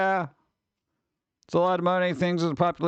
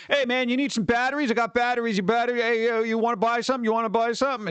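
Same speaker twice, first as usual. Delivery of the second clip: higher-pitched, insistent hustler patter; pushy street peddler; 5 semitones higher than usual. Acted. Subway battery seller hassling a rider, pushy peddler. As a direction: pester them with a repetitive sales pitch.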